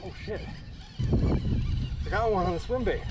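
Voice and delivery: excited high-pitched yelps; keyed up while reeling in; fighting a fish. Real, not acted.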